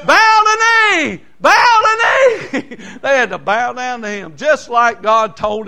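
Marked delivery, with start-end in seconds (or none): 0.1-2.8 high, slow, ringing cry of a herald; herald's shout. Retold or imitated. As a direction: call out like a royal herald clearing the road. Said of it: "a man running in front of him saying".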